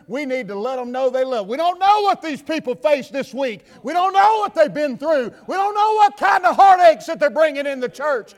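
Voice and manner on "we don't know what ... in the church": higher, louder building appeal; building preaching. Acted. Preacher urging the regulars to love visitors, building. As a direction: climb each line to a shout.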